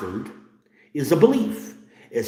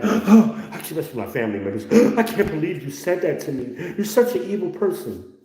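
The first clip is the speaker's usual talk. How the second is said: high, gasping scandalized voice; mock gasp of offense; 7 semitones higher than usual. Offended relative gasping at his words, high. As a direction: gasp and act horrified.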